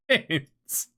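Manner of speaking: word broken up by a laugh; amused, giggly delivery. Real, not acted. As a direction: laugh right through the word. Laughs while finishing the word.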